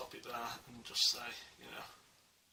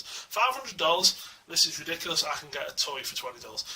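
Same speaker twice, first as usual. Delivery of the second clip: mock American accent; 2 semitones higher, 5 dB louder than usual. Imagined critic calling the statue overpriced, mock American accent. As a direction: play a scoffing American complainer. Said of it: "I did the American accents".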